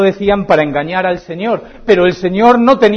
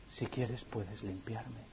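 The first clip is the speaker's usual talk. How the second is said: much softer, almost breathed; hushed plea; 8 semitones lower, 25 dB quieter, slower than usual. Acted. Leper finally reaching Jesus, hushed plea. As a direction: drop to a hush.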